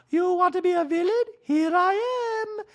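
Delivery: very high, loud dramatic voice; hammed-up villain declaration; Sutton impression. Party guest declaring herself villain, high hammed-up voice. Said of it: "So he's like".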